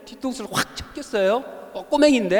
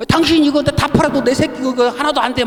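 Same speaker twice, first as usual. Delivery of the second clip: higher, louder mock scolding of the shopkeeper; retold angry yelling; 5 semitones higher, 3 dB louder than usual. Mother shouting at the shopkeeper who hit her son, retold mock anger. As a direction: play the furious mother defending her kid, a bit hammed-up. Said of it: "그렇게 성질 내고 그렇게 소리".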